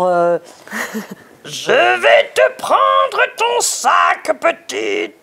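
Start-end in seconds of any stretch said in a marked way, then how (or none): 1.4-5.2 high, slow hammed-up ogre growl. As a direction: drag it out like a storybook ogre. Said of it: "Ça donnait quoi, l'ogre, par exemple?".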